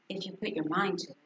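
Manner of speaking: usual speaking voice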